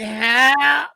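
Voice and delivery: high, drawn-out mock groan; pained moan. Husband left lying on the ice, moaning. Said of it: "You heard me moaning".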